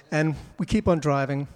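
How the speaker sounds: normal talking voice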